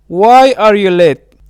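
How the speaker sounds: normal talking voice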